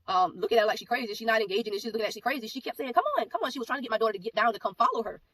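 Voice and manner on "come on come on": much higher, sing-song coaxing imitation of the stranger; mock coaxing lady. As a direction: mimic the odd woman luring the child, pitched way up.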